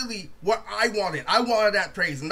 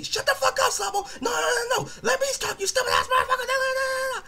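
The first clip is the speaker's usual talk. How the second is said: frantic, very high squeaky protest. Retold or imitated. Roaster mimicking himself interrupting Sabo, squeaky and unintimidating. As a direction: cut in with a high cartoon squeal. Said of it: "my squeaky ass voice".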